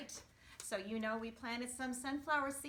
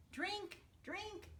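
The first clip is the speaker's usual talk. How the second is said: high, lilting call to the plants; sing-song high encouragement to the sprouts; 9 semitones higher, 4 dB quieter than usual. Acted. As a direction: coax the seedlings from the window. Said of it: "Logan called from his window".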